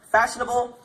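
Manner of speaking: ordinary talk, nothing marked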